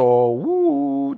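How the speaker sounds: high hooting uh of scandal; mock shocked uh. Teacher reacting to the scandal, high mock hoot. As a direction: squeal it like juicy gossip.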